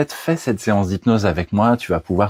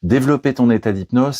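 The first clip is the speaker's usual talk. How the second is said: ordinary talk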